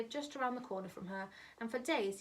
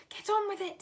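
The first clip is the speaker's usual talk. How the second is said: hissed; 11 semitones higher, 8 dB louder than usual. A narrator's reading. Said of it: "hissed the mother".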